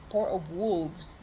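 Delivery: drawn out, each word spelled out; a clear, careful restatement of the name; slow and deliberate. Shown as a demonstration. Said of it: "the animal, yeah".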